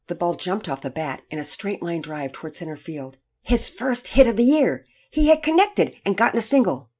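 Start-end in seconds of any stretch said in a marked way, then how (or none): 3.4-6.9 raised, louder, exultant telling; excited narration. A narrator's reading. Narrator celebrates the first hit, excited and loud. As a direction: announce it like a big triumph.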